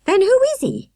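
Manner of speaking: high, loud insistent question; demanding. A narrator's reading. Victoria demanding to know who the man is, loud. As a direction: demand an answer. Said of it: "Victoria demanded".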